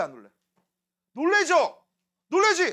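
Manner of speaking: normal talking voice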